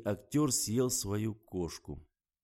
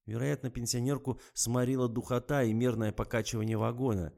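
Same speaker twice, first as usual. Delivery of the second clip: usual speaking voice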